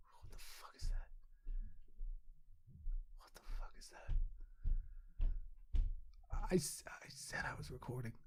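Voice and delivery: hushed whisper, voice gone; real whisper. Real, not acted. Whispering to someone in the room while recording. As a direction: whisper it so the mic won't catch it.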